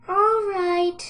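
high, loud drawn-out piglet voice; resigned piglet, pitched high; sighing piglet. A narrator's reading. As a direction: give in with a long, high whine. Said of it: "sighed the piglet".

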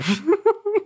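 short high burst of laughter; real laugh. Real, not acted. Streamer laughing at the narrator being shocked, amused.

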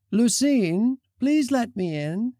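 high-pitched plea through a door; pleading call. Acted. Friend outside asks Lucine to open, pleading. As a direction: beg to be let in, pitched high.

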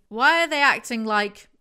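loud, baffled, exasperated ask; exasperated question. Real, not acted. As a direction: throw the question out in disbelief. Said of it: "Audience, fill in the blank".

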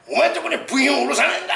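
shouted fast and high, quoting the supplier; mock angry shout. Shop owner imitating the angry supplier's shout. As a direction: bark it like a furious supplier on the phone. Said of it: "ダイレクトにかけて".

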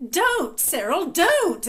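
high, drawn-out scolding; high-pitched reprimand to a child; exclaiming aunt. A narrator's reading. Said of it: "exclaimed the aunt".

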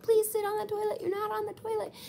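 very high-pitched imitation of pleading nurses; mock yelling. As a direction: yell in a put-on high voice. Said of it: "they're yelling at her the entire time".

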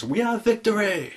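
hammed-up triumphant proclamation; imitated Theoden. King proclaiming the battle won, imitated triumph. Voicing Theoden's victory cry.

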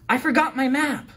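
high, loud sudden cry of dismay; teacher suddenly realizing she forgot her map; exclaiming. Acted. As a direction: gasp it out, she just remembered the map. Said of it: "our teacher exclaimed".

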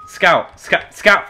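loud, high repeated shout of a name; calling out loud. Real, not acted. Spotting the enemy Scout in the game, a loud, high shout. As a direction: yell the name over and over to mark the target.

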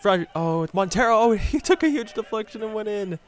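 high, loud and laughing in delight; laughing goal cry. Real, not acted. Gamer cheers a lucky deflected goal, laughing and high. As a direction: squeal with glee as it goes in.

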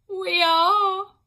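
high, loud wailed confession; a guilty nurse wails her admission; wailing admission. A narrator's reading. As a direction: confess it in a howl. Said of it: "said one of the women".

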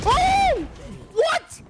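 high, stunned outburst; shocked. Real, not acted. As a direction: gasp it in disbelief. Caster shocked by the play.

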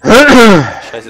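a loud frustrated curse, Scheiße. Real, not acted. Gamer cursing because his character is stuck.

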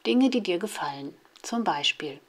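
ordinary delivery

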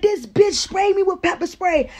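high-pitched, outraged complaint; aggrieved yell. Retold or imitated. Sprayed man telling on her, aggrieved yell. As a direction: yell your accusation over the chaos.

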